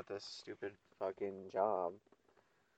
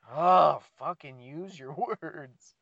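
loud, higher burst of mock impatience; exasperated outburst; 6 semitones higher, 13 dB louder, slower than usual. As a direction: burst out as if fed up with a slow talker. Snaps at the slow drawl.

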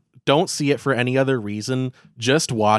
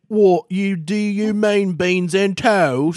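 higher, slow put-on English accent; mock English accent; 8 semitones higher, 4 dB louder, slower than usual. Italian-style order mocked by English diners, mock English accent. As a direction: play a puzzled English waiter in a posh English accent. English locals baffled by him.

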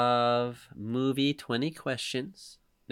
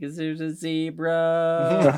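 put-on slow, higher, sleepy child voice; imitating crying kid; 6 semitones higher, 6 dB louder, much slower than usual. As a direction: drag it out like a drowsy toddler. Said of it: "He's just like".

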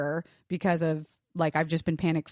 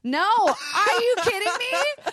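high, laughing disbelief; 5 dB louder, slower than usual. Real, not acted. Excited reply to a joke.

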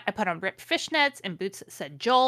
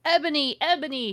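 sad calling; 7 semitones higher, 3 dB louder than usual. Acted. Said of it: "shouted Draco sadly".

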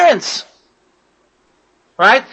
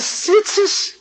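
high scornful "Tzitzis?" then a slow question; a touch of a wife's mock scorn; scoffing disbelief; 7 semitones higher, 4 dB quieter, slower than usual. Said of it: "So the woman says".

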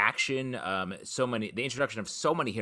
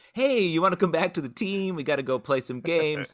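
coaxing teammate; 5 semitones higher than usual. Retold or imitated. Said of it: "be like".

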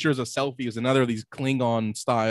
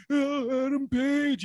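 a much higher, squealed "oh"; imitating a shaking fan overcome with excitement; mock overexcited fan; 13 semitones higher, 3 dB quieter, much slower than usual. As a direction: play the fan who can't contain himself. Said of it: "and he's like".

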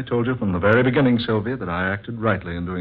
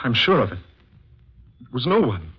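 building from shaken to very high, loud hysteria; rising panic; 9 semitones higher, 4 dB louder than usual. Acted. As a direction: talk yourself into hysteria.

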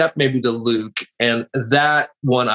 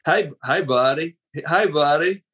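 a light Hank Hill style Texas twang; [name] impression; 2 semitones higher, slower than usual. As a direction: greet them with a lazy Southern twang. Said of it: "it's a little more Hank Hill".

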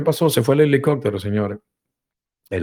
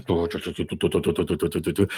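soft low putt-putt motor; boat motor noise; 4 semitones lower, 6 dB quieter than usual. Acted. Narrator mimics the approaching boat, soft motor putt. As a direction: imitate a little boat engine.

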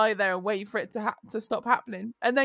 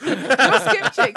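laughing quote; 9 dB louder, much slower than usual. Retold or imitated. Retold stranger's greeting, laughing.

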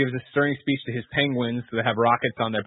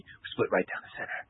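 softer, whispered closing line; breathy whisper; 4 semitones lower, 10 dB quieter than usual. Retold or imitated. Batman to Selena, softly whispered. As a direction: hush it like a confession. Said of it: "he's like".

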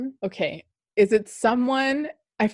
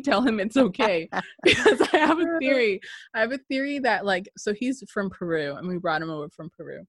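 usual speaking voice

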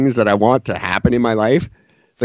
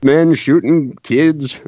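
normal talking voice

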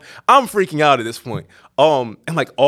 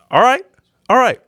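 high, louder rising "all right"; uneasy agreement; 11 semitones higher, 5 dB louder than usual. Retold or imitated. Nervous tourist answering the friend's plan, uneasy agreement.

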